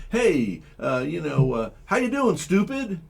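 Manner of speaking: high, jokey fake-friendly hello with a put-down; mock friendly greeting. Host mocking how rappers greet each other, high mock cheer. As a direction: chirp it like a cheery insult. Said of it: "it's like saying".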